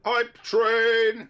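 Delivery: loud excited yell; hype yell. Real, not acted. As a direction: shout it out with hype. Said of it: "Sorry, I'm just gonna yell".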